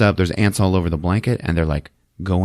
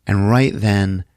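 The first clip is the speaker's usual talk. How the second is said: usual speaking voice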